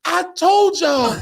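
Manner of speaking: high, drawn-out I-told-you; mock outraged yell. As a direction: draw it out slowly, high and indignant. Retold yell after window breaks.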